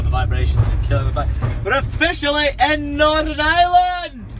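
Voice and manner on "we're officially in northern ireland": a hint of Scottish accent, higher and louder. Vlogger announcing arrival to camera, light Scottish accent. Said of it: "I don't know why I still sound Scottish".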